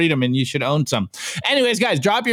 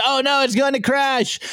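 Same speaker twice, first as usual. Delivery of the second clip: much higher panicked cry of a cartoon hodler; mock panic, pitched way up; panicky little hodler; 9 semitones higher than usual. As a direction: squeal it like a worried little investor. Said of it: "a little hodler over here who goes".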